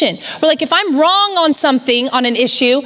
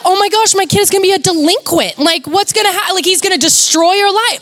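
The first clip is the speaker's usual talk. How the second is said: high-pitched, hammed-up alarm; mock panic; 7 semitones higher than usual. New mom told her kid is ruined, mock panicked. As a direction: act out an overwhelmed parent, pitched high. Retold panic at strict advice.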